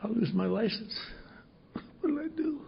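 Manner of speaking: high, thin whimper of panic; mock whimpering despair. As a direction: imitate a desperate doctor close to tears. Hungover doctor in despair.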